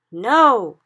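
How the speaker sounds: loud pained no; crying out. Acted. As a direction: cry it out in pain. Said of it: "cried the B F G".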